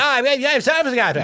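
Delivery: high, loud garbled mock-Tasmanian shout. Imitating Tasmanians drifting off from the mainland, loud garbled mock Tasmanian. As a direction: holler like an incomprehensible Tasmanian. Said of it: "they're all like".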